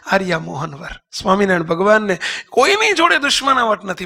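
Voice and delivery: normal talking voice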